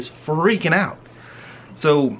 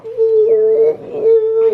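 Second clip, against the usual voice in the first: excited squeal; 6 dB louder than usual. Retold or imitated. Racing up the hill.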